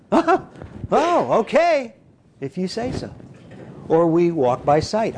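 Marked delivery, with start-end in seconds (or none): 0.1-3.1 higher, light voice of a believer who simply agrees; a hint of a cheerful believer saying yes; trusting believer. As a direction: chirp it out like easy trust. Believer voiced accepting God's word.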